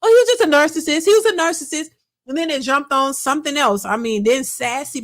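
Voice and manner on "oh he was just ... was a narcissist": mock-dismissive, a bit higher, louder and faster; mocking quote. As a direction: toss it off like gossip everyone repeats. Mocking what everybody says.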